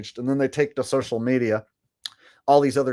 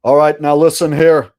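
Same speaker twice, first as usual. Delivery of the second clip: much louder booming wrestling promo voice; The Rock; 3 semitones higher, 8 dB louder than usual. Pretend. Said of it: "I'm gonna have the Rock interpreting".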